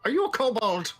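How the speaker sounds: put-on high, narrow-eyed old woman; suspicious old barmaid. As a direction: squint and question, high. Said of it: "her eyes narrow, and she goes".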